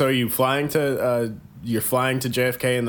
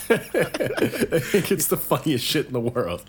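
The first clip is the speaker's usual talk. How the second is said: laughing; 4 semitones higher, slower than usual. Real, not acted. Laughing at Outback joke.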